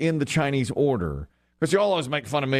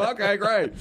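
higher, through laughter; laughing acceptance; 13 semitones higher than usual. Retold or imitated. Diner accepting mystery food, laughing acceptance. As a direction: accept it happily while laughing.

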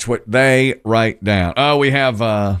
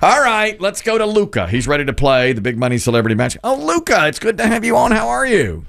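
bright, higher host voice welcoming the caller; game show host; 8 semitones higher than usual. Acted. Host opens game segment.

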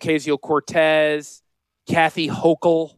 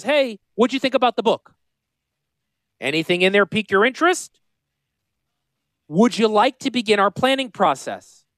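office follow-up caller; 6 semitones higher than usual. Acted. Acts out office staffer's follow-up call.

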